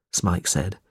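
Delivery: ordinary delivery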